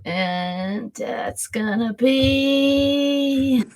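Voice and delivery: long held syllables before the reveal; drawn-out suspense. Real, not acted. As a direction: stretch each word out while waiting on the dice. Drawing out suspense before roll result.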